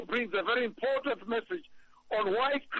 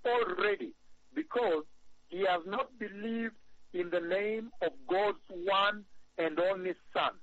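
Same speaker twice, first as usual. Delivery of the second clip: scripture reading. Acted. Reads scripture aloud slowly.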